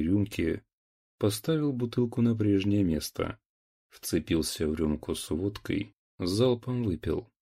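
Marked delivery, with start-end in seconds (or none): none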